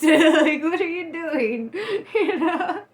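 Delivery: high laughing through the words. Real, not acted. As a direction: laugh it out, breaking up. Laughing at the absurd choice.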